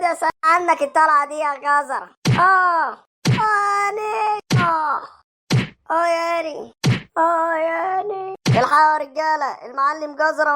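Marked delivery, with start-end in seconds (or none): 2.4-8.3 slow, high moaning aah; hammed-up pained groans. Beaten thug groaning while the gang watches. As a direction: drag out the groans of pain.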